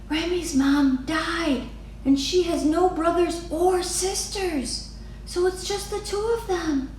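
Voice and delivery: put-on a small girl sharing sad news; little girl Lily. A narrator's reading. Lily tells Mama quietly.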